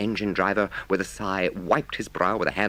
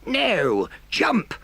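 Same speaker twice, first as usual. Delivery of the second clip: high loud call; 3 dB louder than usual. Acted. Driver calling Toad to jump from the slowing train, loud. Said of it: "he heard the driver call out".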